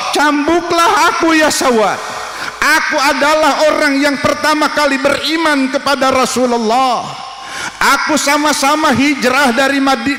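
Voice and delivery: indignant plea. Acted. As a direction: scold the man, then offer yourself. Abu Bakar rebukes and pleads.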